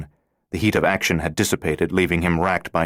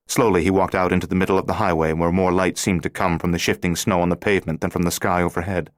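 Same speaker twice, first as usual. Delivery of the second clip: usual speaking voice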